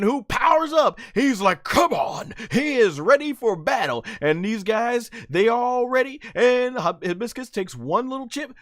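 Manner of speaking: high, excited fight commentary; live play-by-play. Acted. Fan narrating an anime fight to viewers, hyped play-by-play. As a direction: call the action like a ringside announcer.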